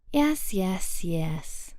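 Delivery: lightly giggly, breathy; playful, airy brush-off. A narrator's reading. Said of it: "Cynthia giggles".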